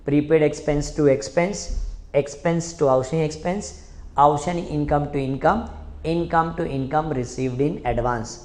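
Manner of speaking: slow, evenly chanted entries; slow chanted memory drill; slow recitation drill. Shown as a demonstration. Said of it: "last time बोलो बेटा".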